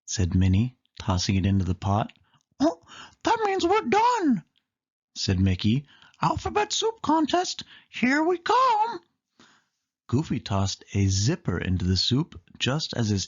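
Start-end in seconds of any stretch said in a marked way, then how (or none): 2.6-4.4 much higher cartoon mouse; Mickey voice. Mickey declares soup done. 6.2-9.0 much higher, slowing mouse voice; Mickey voice. Acted. Mickey cheers for the contest.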